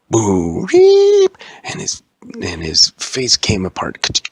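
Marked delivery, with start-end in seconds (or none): 0.1-1.4 loud boo rising to a very high squeal; jump-scare noise. Acted. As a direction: blurt a loud, shooting-up scare sound. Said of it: "Watch this. And he went".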